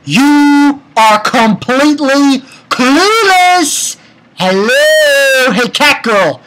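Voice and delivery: high, drawn-out incredulous call; exasperated mock scolding. Fan calling out a clueless cat girl, mock exasperation. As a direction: stretch it out as if to someone oblivious.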